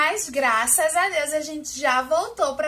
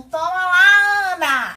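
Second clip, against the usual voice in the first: loud, high toss to a friend; big playful handoff shout; playful call-out; 4 semitones higher, 8 dB louder, slower than usual. Pretend. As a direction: call out as you throw it over, stretching the name. Pretends to throw dice to friend.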